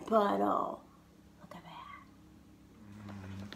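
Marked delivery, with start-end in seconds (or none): 1.4-3.6 slow, low, breathy whisper. Real, not acted. Grandma whispering to Lucas about the pigs. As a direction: hush it like a secret.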